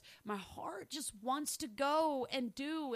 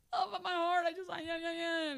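higher, hammed-up feeling-everything voice; mock tearful whine; 7 semitones higher than usual. Herself mocked, all feelings.

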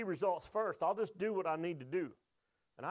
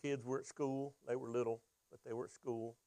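normal talking voice

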